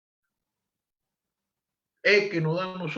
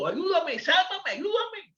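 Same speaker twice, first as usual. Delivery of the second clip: high, urgent cry for help; a desperate plea, pitched high; high pleading cry; 12 semitones higher, slower than usual. Retold or imitated. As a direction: beg God to save you. Voicing the plea to God.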